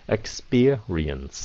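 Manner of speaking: Experience. drawn out slowly, syllable by syllable; slow careful English. Shown as a demonstration. Teacher modelling Experience. slowly for viewers. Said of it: "Rồi bắp viên sao?".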